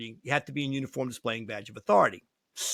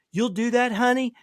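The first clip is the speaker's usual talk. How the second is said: high-pitched, slightly louder woman's voice; client sweet-talking a helper; 9 semitones higher, 4 dB louder than usual. Retold or imitated. Client asking her young lawyer for a favor, high-pitched sweet-talk.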